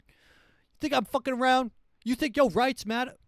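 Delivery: high, loud, fast furious yell; ranting president. Retold or imitated. Future president yelling at citizens about aliens, furious high shout. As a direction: bellow at the crowd like an unhinged leader. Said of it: "That's the president".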